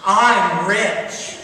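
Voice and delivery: put-on big, loud line for the child to copy; loud demonstration. Shown as a demonstration. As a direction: belt it out for everyone to hear. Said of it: "say it really loud".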